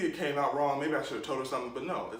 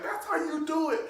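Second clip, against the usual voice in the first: much higher, thrilled and cheering; impressed friends. Retold or imitated. Friends cheering the kid who beat the teacher, high and thrilled. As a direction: squeal it like the kid just won. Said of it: "My friends was looking at me like".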